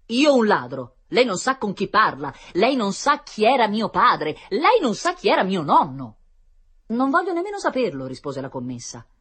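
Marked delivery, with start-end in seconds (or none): 0.1-6.2 loud, raised-pitch offended gentleman; protesta indignata. A narrator's reading. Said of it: "Il signore protestò". 6.9-8.0 high, loud dismissive retort; replica sprezzante. A narrator's reading. Shop assistant retorts in quarrel.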